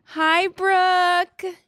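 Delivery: high, drawn-out sing-song hello; affectionate greeting. Real, not acted. Greeting a missed friend by phone.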